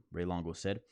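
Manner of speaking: ordinary talk, nothing marked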